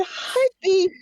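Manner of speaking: high-pitched grandma greeting. Acted. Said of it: "I'm speaking in my grandma voice".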